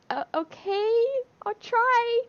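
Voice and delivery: high, rising, unsure retold reply; mock hesitant. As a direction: agree in a small, doubtful high voice. Retold doubtful reply to therapist.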